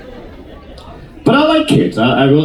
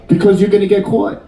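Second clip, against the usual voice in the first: ordinary delivery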